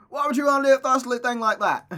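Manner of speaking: loud, high mock-British shout; Gavin Free impression. Friend questioning the writer's wish, hammed-up British accent. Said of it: "just completely change your accent".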